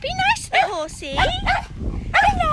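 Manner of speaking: way up high, gentle coaxing; high-pitched pet talk. Real, not acted. Coaxing a horse.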